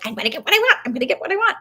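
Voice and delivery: stubborn terrier. Pretend.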